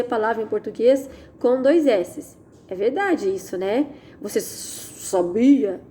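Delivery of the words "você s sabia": "sabia" com o S inicial longo e sibilado; S inicial esticado. Shown as a demonstration. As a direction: prolongue muito o S no começo de "sabia". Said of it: "A gente não fala assim". ("sabia" dito errado de propósito, como "sssabia")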